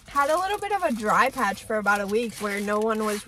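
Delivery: talking between bites; words muffled by food; mouth full, chewing. Real, not acted. Seen 1: Bites sandwich just before; cheeks full and jaw working into early words.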